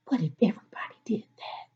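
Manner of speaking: quick hushed librarian whisper. Acted. Librarian hushing a child during story time, quick whisper. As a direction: shush them in a breathy library whisper. Librarian finger to her lips.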